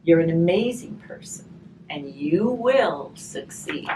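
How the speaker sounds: gentle storybook reading, slow on the rhymes; reading to children. Acted. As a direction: read the rhyme aloud to the children. Reading a rhymed picture book aloud.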